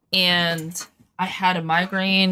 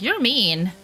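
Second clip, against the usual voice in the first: mock whiny kid; 5 semitones higher than usual. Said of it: "It was like".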